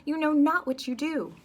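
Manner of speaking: loud, high call to stop the fight; loud high commanding cry; commanding shout. Acted. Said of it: "he says".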